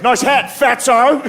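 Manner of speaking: high, slow mock Australian jeer; Australian accent. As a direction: taunt in a drawn-out Australian accent. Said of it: "You sound funny".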